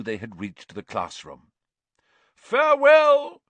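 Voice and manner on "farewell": high, loud, long-drawn goodbye; crying out. A narrator's reading. Knight pops into monks' painting to part, crying out. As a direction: sing out a grand knightly parting call. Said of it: "cried the knight".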